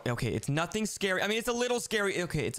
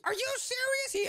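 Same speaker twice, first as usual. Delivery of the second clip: upset cry. Acted. Catnap got caught.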